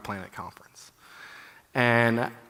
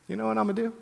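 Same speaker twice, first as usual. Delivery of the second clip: eager self-talk; 9 semitones higher, much faster than usual. Retold or imitated. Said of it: "I go".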